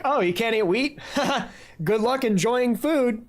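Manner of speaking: laughing, mock-cheery brush-off; mocking dismissal. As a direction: scoff and laugh them off. Acting out a past dismissive attitude.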